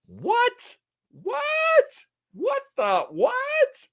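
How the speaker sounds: hammed-up shocked squeal at the news; mock disbelief. Host squeals in mock shock at Edward G. Robinson casting, high disbelief.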